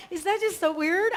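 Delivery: high, loud amazed question; bright, amazed exclamation. Retold or imitated. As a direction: sound delighted and a little spooked. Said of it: "And I'm like".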